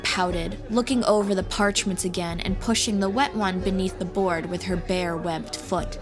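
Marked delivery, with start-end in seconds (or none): none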